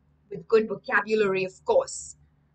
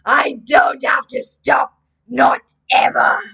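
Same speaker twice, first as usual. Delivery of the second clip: slow, loud, measured threat; menacing story character; 2 semitones higher, 9 dB louder, slower than usual. A narrator's reading. Devil answers Jeff's plea to stop, slow loud menace. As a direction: speak it slowly and loudly, each word weighted.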